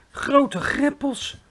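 high, slow, loud awed exclamation; a giant gasping in wonder; slow, high giant marvel; amazed giant. A narrator's reading. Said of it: "fluisterde de grote vriendelijke reus".

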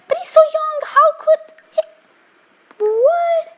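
very high, squeaky incredulity; shocked disbelief. Real, not acted. Player learns young Sim has top career, high-pitched shocked disbelief. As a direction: voice shoots up, baffled and amazed. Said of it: "I'm shocked".

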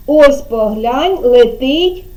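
dole out the cue slowly, stop on "О"; leaving word unfinished. Shown as a demonstration. Teacher prompting a child to finish, slow with suspended "О". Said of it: "а ви будете закінчувати".

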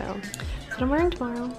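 usual speaking voice

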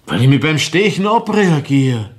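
höher und lauter herausgestoßen; plötzlich aufbrausen; ausbrechend. Acted. Mörder erklärt Motiv ausbrechend. (lauter Ausbruch)

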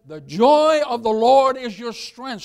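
loud, slow verse with JOY stretched; emphatic proclamation. Real, not acted. Pastor quoting Nehemiah to congregation, loud proclamation. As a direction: proclaim it, drawing out the key words. Repeats verse with preaching force.

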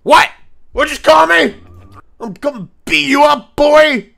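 high, loud mock-threatening yell; mock outrage. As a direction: yell at the recording as if it were a rival. Shouting at the old recording.

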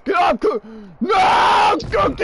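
high, loud frantic yelling; panicked shout. Real, not acted. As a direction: shout desperately to shake someone off. Panicked yelling during game attack.

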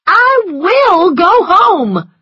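high, loud, drawn-out wail; tearful defiant shout; desperate cry. A narrator's reading. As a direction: cry it out slowly, at full pitch. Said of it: "she cried".

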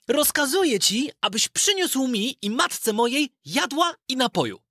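put-on high boyish voice, bold and delighted; a lad boldly ordering the blue spirit; bold young Aladyn. Aladyn boldly commands the blue spirit for food, high boyish voice. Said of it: "spojrzał śmiało na ducha i rzekł".

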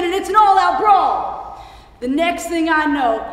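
worked-up account of a brawl; excited storytelling. Acted. Storyteller reaching the brawl's climax.

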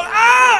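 high, loud mock scream of pain; sudden acted scream; retold pain yell. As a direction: yelp as if your foot got stomped. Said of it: "So I yell out".

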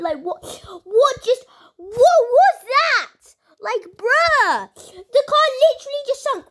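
can't believe what just happened; excited disbelief. Real, not acted. Gamer telling viewers the car just sank: high, loud, amazed.

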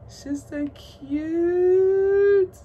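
high, loud, drawn-out squeal; squealed cute. Real, not acted. Collector squealing at her doll, long high squeal. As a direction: stretch out the cute in delight.

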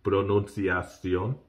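"Pronunciación" drawn out slowly, lifting at the end; learner's slow, questioning "Pronunciación"; careful pronunciation practice. Shown as a demonstration. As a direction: sound out "Pronunciación" slowly, as if checking it. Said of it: "volvamos a decirlo, diga".

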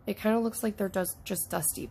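usual speaking voice

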